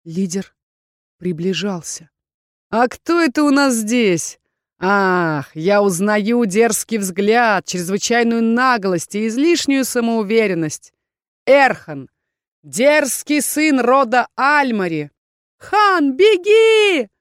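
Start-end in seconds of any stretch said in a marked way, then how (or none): 2.7-15.1 loud, drawn-out taunting villain; mocking villain leader. Rebel leader recognising the insolent Erkhan, taunting. Villain sizes up his enemy. 15.6-17.1 very high, loud warning cry; desperate scream. A narrator's reading. Heroine warning Erkhan to run, screaming. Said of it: "закричала я".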